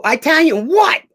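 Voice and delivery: high, loud, slow disbelief; baffled retort. Retold or imitated. Joe hearing the odd casting request, high incredulous shout. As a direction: throw it back in total disbelief.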